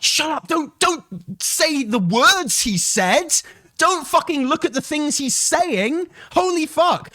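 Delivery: furious yell. Real, not acted. Streamer yelling at chat to stop ignoring words, furious.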